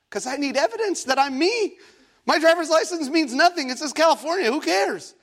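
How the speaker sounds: high-pitched, worked-up retelling; mock alarm. Officer still has the passport, comic alarm. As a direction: rattle it off in comic panic.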